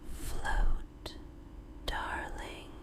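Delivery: ordinary delivery, nothing marked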